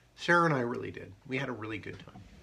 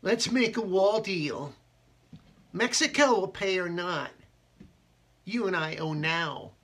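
slow, higher, louder mock Trump voice; Donald Trump impression; 9 semitones higher, 6 dB louder than usual. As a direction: ham up Trump for laughs. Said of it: "that's my Donald Trump".

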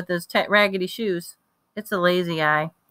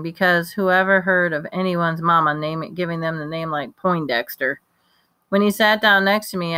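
plain reading voice

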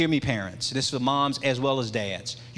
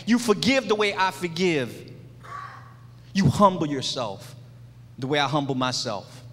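raised-pitch, rhythmic litany, each line falling at the end; impassioned preaching; 5 semitones higher than usual. Real, not acted. Father charging his son to follow his example, impassioned preaching. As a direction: preach it in driving parallel phrases.

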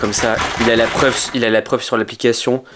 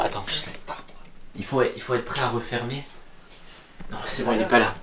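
hushed; 3 semitones lower, 11 dB quieter than usual. Acted. Hiding, afraid to open.

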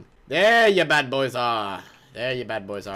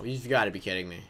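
normal talking voice

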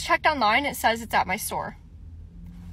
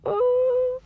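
hopeful excitement; 8 semitones higher, 4 dB louder, slower than usual. Real, not acted. Hears another store has it.

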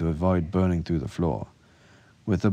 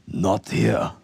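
raised, strained croak; 4 semitones higher, 4 dB louder than usual. A narrator's reading. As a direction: force it out through a bruised throat. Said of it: "Horka croaked through his bruised throat".

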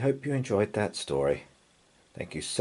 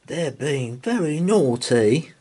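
put-on little boy Tom; 8 semitones higher, 5 dB louder than usual. A narrator's reading. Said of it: "said Tom".

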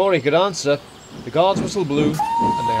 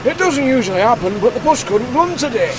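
higher, louder pleading voice; apologetic manager; 8 semitones higher, 4 dB louder than usual. A narrator's reading. Quarry manager excusing himself to the inspector, apologetic. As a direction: apologise to an official.

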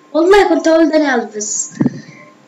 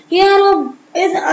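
despairing plea; 6 semitones higher than usual. Real, not acted. Vlogger crying out as he loses again, high pleading outburst. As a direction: cry out to the sky. Dismay at another mistake.

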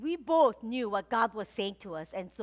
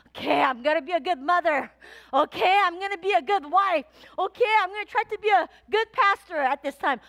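louder, driven, pushing through; strained determination; 5 semitones higher, 6 dB louder than usual. Retold or imitated. Pastor mimicking her toiling self, loud and driven. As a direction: grit your teeth and push on. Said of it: "I was just toiling".

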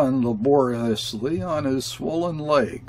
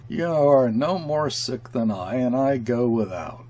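usual speaking voice

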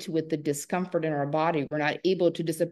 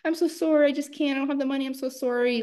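much higher, rushed whiny sorry; apologetic people pleaser; 8 semitones higher, faster than usual. Retold or imitated. A woman pleading with a seller, high and whiny. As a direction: squeak out the apology.

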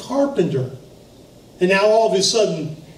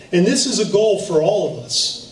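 normal talking voice

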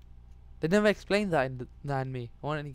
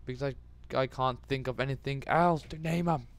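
ordinary delivery

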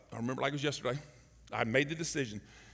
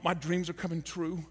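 normal talking voice

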